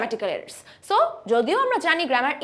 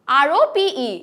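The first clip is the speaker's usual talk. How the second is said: usual speaking voice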